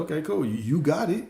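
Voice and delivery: high-pitched, agreeable yes-man; breezy "whatever you say" voice; easygoing compliance. Acted. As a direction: go along with it, pitched well up. Voices reality obeying the order.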